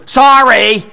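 high, loud mock child's yell; little girl's defiant sorry. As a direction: imitate a small child blurting out an apology. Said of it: "That's the tone of voice".

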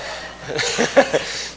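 airy unvoiced chuckle; quiet laugh at himself; breathy laugh. Real, not acted. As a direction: let out a soft laugh. Laughing at own joke.

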